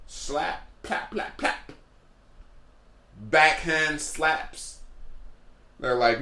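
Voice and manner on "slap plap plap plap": soft slap sounds made with the mouth; mimicked slap noises. Comic telling his crowd about the slaps, with soft mouthed slap sounds. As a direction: click out quiet slaps.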